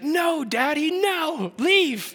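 high little-girl voice imitating a two-year-old; toddler daughter. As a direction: squeak it out like an upset toddler. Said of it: "and she's like".

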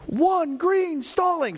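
high, slow, drawn-out ref call; referee call. Retold or imitated. Referee warns the wrestler for stalling, slow high call. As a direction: announce it like a referee. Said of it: "and he said".

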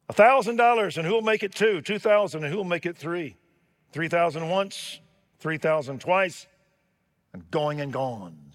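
loud, high rapid bidding call; excited auctioneer. Acted. Auctioneer taking bids after the master plays, excited and loud. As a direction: sell it with mounting thrill.

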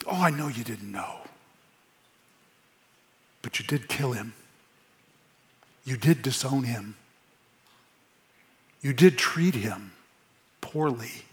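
hushed, tender rebuke; softness and tenderness. Retold or imitated. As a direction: tell a hard truth tenderly. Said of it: "this softness and this tenderness".

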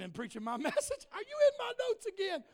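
high, loud mock outrage; joking accusation. Real, not acted. Preacher teasing someone over stolen sermon notes, loud mock outrage.

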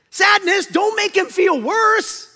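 loud, high-pitched exasperated scolding; Joy. Acted. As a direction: shriek a slow, drawn-out reprimand. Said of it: "Joy says".

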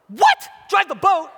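loud, high shriek of disbelief; incredulous shock. Retold or imitated. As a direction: blurt it back in mock alarm. Shocked at being told to drive.

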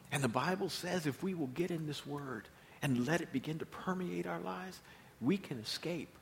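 ordinary talk

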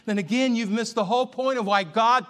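loud, high, building sermon climax; preaching at full force. Acted.

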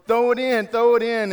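high, loud yell of a coach. Retold or imitated. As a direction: shout it to a kid across the field. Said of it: "the coach starts yelling".

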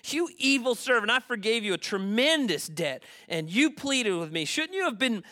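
loud, high, indignant rebuke; voiced outraged king from scripture; angry king. A narrator's reading. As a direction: rebuke the servant at full preaching force. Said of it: "Then the angry king sent the man".

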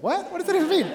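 high-pitched, incredulous questioning; mock bafflement. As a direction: act out a puzzled double take. Acted baffled reaction to odd answer.

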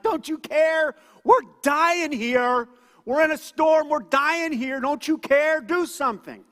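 shouted, high and loud, frantic; disciples yelling in panic. Retold or imitated. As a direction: yell at Jesus as the boat sinks. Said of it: "they actually start yelling at Jesus".

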